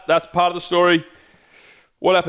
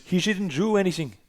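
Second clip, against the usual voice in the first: mock Portuguese accent, pitched higher; 5 semitones higher, 4 dB quieter than usual. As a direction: do a playful Portuguese accent, up high. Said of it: "That was my Portuguese accent".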